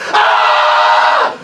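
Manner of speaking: high, loud, drawn-out scream. Retold or imitated. Drunk woman screaming the accusation at the shop worker. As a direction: shriek the accusation across the shop. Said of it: "runs the entire length of the store screaming".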